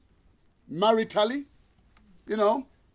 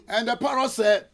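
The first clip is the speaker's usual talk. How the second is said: ordinary talk, nothing marked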